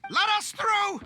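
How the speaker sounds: high, urgent shout; pleading shout. Acted. Citizen shouting at guards to be let through, high and urgent. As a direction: force your way through.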